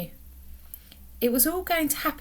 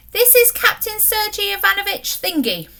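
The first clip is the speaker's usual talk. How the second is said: higher, louder excited introduction; proudly presenting her captain; excited girl; 10 semitones higher, 6 dB louder than usual. A narrator's reading.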